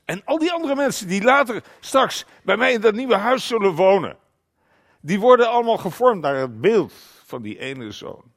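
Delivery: preacher in full voice; emphatic preaching. Real, not acted. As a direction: proclaim it forcefully to the congregation. Preacher proclaims God's plan at force.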